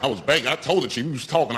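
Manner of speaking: ordinary talk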